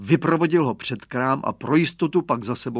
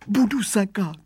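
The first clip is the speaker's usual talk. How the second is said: higher whining promise; whiny voice; 8 semitones higher than usual. Acted. Caught boy promising the gardener, higher whiny voice. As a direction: whine the promise like a cornered brat. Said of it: "sliboval výrostek kňučivým hlasem".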